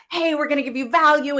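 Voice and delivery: high, fast, bright-eyed showtime opener; hype host voice. Retold or imitated. Host imitating her own upbeat stream opener, hyped and fast. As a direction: pump it up like kicking off the livestream. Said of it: "you see me come on with the lights".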